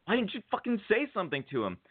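quick, high-pitched retold prodding; exasperated friend. Friend urging the fan to speak up, exasperated. Acts out Willoughby's urging reply.

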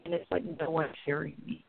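normal talking voice

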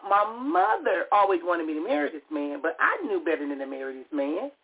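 usual speaking voice

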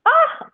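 sudden high, loud "ah"; sharp high exclamation of wonder; loud high gasp. Real, not acted. Overcome on hearing the reading.

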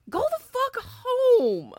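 slow emphatic scold. Real, not acted. Telling off the customer, slow and loud. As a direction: spell each word out to her.

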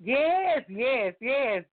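high, loud, emphatic yeses; excited agreement. Real, not acted. Fan agreeing that her reader side came out, excited. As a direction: burst out in real delight.

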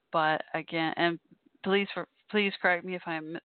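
ordinary talk, nothing marked

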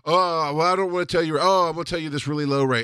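high-pitched mock reluctance; mocking mimicry. Husband mimicking wife afraid to name her rate, mocking. Mimics wife's excuses for undercharging.

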